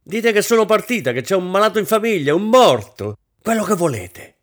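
loud, high, hysterical outburst; vehement. A narrator's reading. Hostess cancelling the ball, vehement. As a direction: hurl out excuses at full vehemence. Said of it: "continuò con veemenza crescente".

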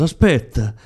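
aspetta cried out high and loud; near shout. A narrator's reading. Woman crying out to her leaving lover, near shout. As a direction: call him back, almost shouting. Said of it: "aveva quasi gridato".

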